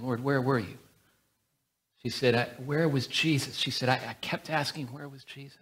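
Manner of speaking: quiet, emotional, barely held; softly moved retelling; hushed and moved. Real, not acted. Telling the tearful healing.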